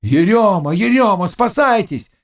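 high alarm cry, climbing; urgent warning yell, pitch rising; crowd shouting. A narrator's reading. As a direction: raise the alarm. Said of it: "кричали другие".

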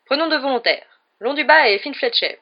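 loud, high, showy announcement; calling for volunteers. A narrator's reading. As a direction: call out to the whole hall. Lockhart calls up volunteers. (appel lancé haut et fort)

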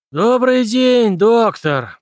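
high, loud, slow wheezy greeting; wheezing. A narrator's reading. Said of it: "прохрипел Дональд Пембертон".

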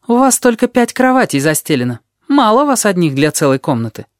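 put-on high, cheeky little boy voice; sly high kid, teasing; odd kid voice. A narrator's reading. Вонючка speaking.

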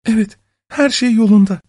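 high-pitched woman's character voice; submissive wife. A narrator's reading. Wife assuring husband all is well, high anxious voice. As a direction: answer high, keeping up appearances.

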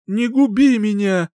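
high, loud terrified begging; frightened plea. A narrator's reading. Sinking youth begs the singer to spare him, high frightened cry. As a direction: beg for your life. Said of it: "взмолился Похьелец, перепугавшись".

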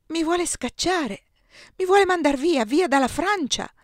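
rising alarm at being sent away; dismayed disbelief. A narrator's reading. Eugenia to the emperor, shocked and loud.

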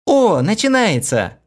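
high, slow excited shout; excited cry. A narrator's reading. Хлоя sees the show starting, excited cry. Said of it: "вскрикнула Хлоя".

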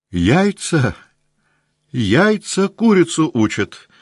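high, tearful with joy; through happy tears. A narrator's reading. Old count tearful and happy, embracing his wife. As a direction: choke it out, crying with happiness. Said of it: "сквозь счастливые слёзы проговорил граф".

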